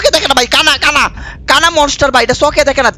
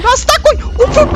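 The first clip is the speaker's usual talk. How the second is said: panicked cry. Real, not acted. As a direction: shriek looking for a way out. Trapped by monster.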